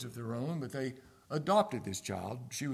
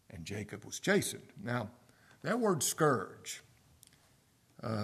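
ordinary delivery

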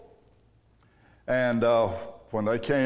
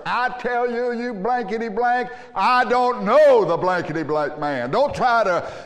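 higher, louder cursing outburst; cursing denial; 8 semitones higher, 4 dB louder than usual. Retold or imitated. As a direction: swear him off, hot and loud. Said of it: "Peter said with profanity, with a curse".

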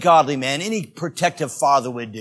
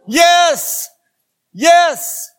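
emphatic yes; 11 semitones higher, 8 dB louder than usual. Real, not acted. Affirming his shocking claim.

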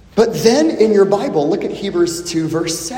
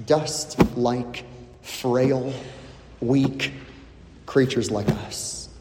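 softer, lower, each word set apart; 8 semitones lower, 8 dB quieter, slower than usual. Acted. Preacher describing frail humans to congregation, soft and low. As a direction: lay each word down gently.

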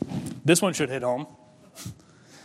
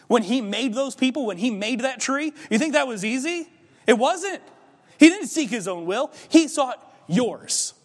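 rising preacher fervor; 5 semitones higher than usual. Real, not acted. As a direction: climb to a passionate peak. Preacher building to climax.

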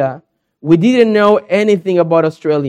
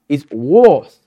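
"was" said lower, with an Aussie vowel; Australian "was"; 6 semitones lower than usual. Retold or imitated. Daughter correcting dad's "was" with an Aussie vowel, lower. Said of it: "she's correcting my accent".

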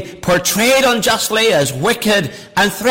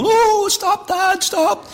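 put-on high, pleading child's voice; scared kid; 11 semitones higher, slower than usual. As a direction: beg like a frightened child. Scared kids pleading, quoted.

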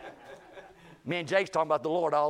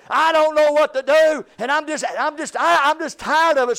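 exasperated outburst; 12 semitones higher, 13 dB louder than usual. Retold or imitated. Preacher voicing coworker's loud fed-up outburst. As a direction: throw up your hands, fed up.

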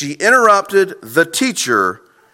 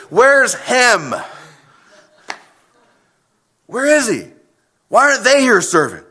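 raised-pitch, indignant complaint; judgmental; 4 semitones higher than usual. Acted. A church volunteer resenting those not serving, judgmental. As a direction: ask it accusingly, pitched up. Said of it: "to this kind of judgment".

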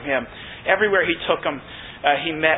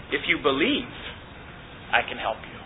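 soft, low and tender; gentle promise; 3 semitones lower, 9 dB quieter than usual. Retold or imitated. Jesus reassures the desperate father, soft and tender. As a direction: draw him in softly.

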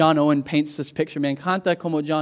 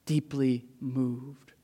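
much softer and lower, words drawn out; hushed and slow; 3 semitones lower, 10 dB quieter, much slower than usual. Acted. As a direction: let the words sink in quietly. Hushed repeat of the earlier cry.

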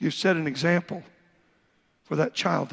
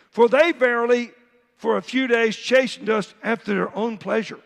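louder preaching read; preacher reading Hebrews aloud, louder; scripture reading; 3 semitones higher, 5 dB louder than usual. Acted. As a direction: proclaim the verses with force.

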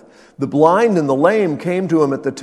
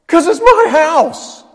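much higher, loud and slow; emphatic shout; 11 dB louder, slower than usual. Pretend. Pastor defending his home, high loud shout. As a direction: hammer home that it's his house.

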